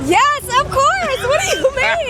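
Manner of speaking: high-pitched, drawn-out, emphatic yes; emphatic excitement. Real, not acted. Detroit fan asked about the Lions' Super Bowl chances, excitedly emphatic. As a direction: answer as if there can be no doubt, pitched high.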